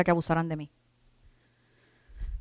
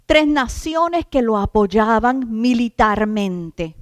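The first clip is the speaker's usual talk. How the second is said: fervent preaching; 4 semitones higher, 5 dB louder than usual. Acted. Preacher rousing the congregation, emphatic sermon. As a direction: preach it out to the flock.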